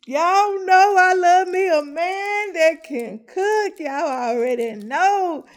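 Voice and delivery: loud, high, drawn-out declaration; excited gushing. Real, not acted. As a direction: gush slowly and loudly, savoring every word. Gushing about a man who cooks.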